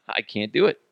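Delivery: ordinary delivery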